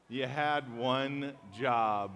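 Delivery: slow, stretched-out punchline; drawn-out catchphrase. Pretend. Pastor drags out the meme line slowly for the crowd. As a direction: let each word hang for the laugh.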